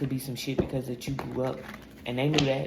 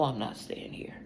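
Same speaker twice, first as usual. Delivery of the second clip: soft unvoiced whisper; hushed determined whisper; 5 dB quieter than usual. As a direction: breathe it out as a whisper.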